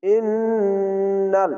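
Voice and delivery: very slow ইন্নাল, the doubled ন hummed long through the nose; wajib ghunna. Shown as a demonstration. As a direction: hold the ন্ন nasally. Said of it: "এখানে ওয়াজিব গুন্না". (ওয়াজিব গুন্না দিয়ে ইন্নাল)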